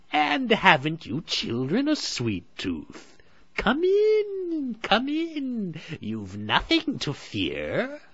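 high, slow, sweetly luring; coaxing witch. A narrator's reading. Old woman lures children inside, slow and sugary. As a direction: draw them in like a sly hag. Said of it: "went on the old woman".

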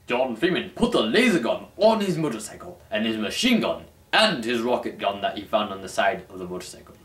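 ordinary talk

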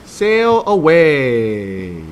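sail away stretched very slow; drawn-out. Acted. Mister Chad reads the title to children, very slow. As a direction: let the words drift out long and slow.

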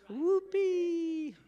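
high, drawn-out whoop; loud put-on squeal of excitement; mock cheer. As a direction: cheer it way up high, tongue in cheek. Mock cheer about the ride.

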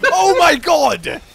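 high, frustrated yell; exasperated shouting. Real, not acted. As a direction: shout it at the end of your patience. Stuck on one road section.

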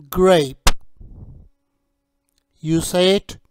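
slow, clear teacher voice modelling each word; teacher drilling a young English learner on fruit names; vocabulary drill. Shown as a demonstration. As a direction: model each fruit name for a child to repeat.

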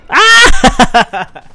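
startled scream. Real, not acted. Caught by enemy.

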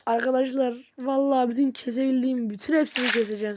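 loud exasperated cry; frustrated outburst. Real, not acted. Gamer losing a fight, loud dismayed cry. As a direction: let the frustration burst out loud.

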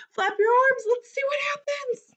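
high, hurried squeal; frantic excitement. Acted. Storyteller has kids do everything at once, excited squeal. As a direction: rush it out way up high.

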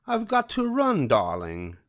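haughty high Cruella farewell; Cruella de Vil. A narrator's reading. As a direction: toss it off while leaving. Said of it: "Cruella said".

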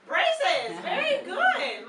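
high, loud delighted praise, slow laugh on good; excited praise. Real, not acted. Teacher praising a child's answer, high and loud with a laugh.

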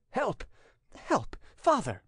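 high-pitched, drawn-out plea; urgent appeal. A narrator's reading. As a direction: implore your father for help. Son urges father to strike.

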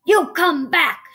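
pirate captain bellowing after his men; shouted order. A narrator's reading. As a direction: call them back forcefully. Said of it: "shouted Captain Bones".

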